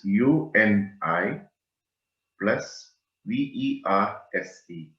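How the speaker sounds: slow, letter-by-letter spelling; each letter given its own beat; spelled out. Shown as a demonstration. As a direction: spell it out slowly, pausing between letters. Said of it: "spell it out".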